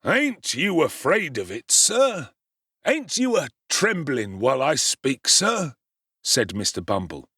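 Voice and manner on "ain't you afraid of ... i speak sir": pompous, slow threat rising on each sir; lightly voiced bully trying to scare; menacing beadle. A narrator's reading. Bumble trying to frighten Oliver, mock menace.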